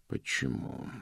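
soft, pensive mutter; thoughtful murmur. A narrator's reading. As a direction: murmur it as if pondering. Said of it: "задумчиво пробормотал он". (задумчивое бормотание)

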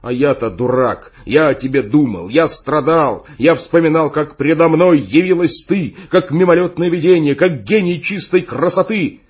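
high, loud outrage turning lofty; shouted tirade. A narrator's reading. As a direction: rant with impassioned, declamatory fury. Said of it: "закричал на неё". (гневный патетический крик)

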